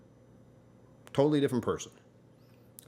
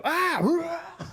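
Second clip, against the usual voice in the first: much higher, louder shouted cheer; mock battle cry; 4 dB louder, much slower than usual. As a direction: ham up a tiny game crewman cheering. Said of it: "you just have".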